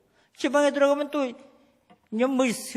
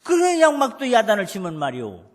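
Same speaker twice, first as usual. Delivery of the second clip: ordinary talk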